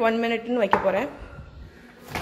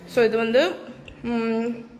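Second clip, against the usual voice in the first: chewing while talking; much slower than usual. Real, not acted. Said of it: "முழுங்கிட்டு சொல் பேசுறேன்".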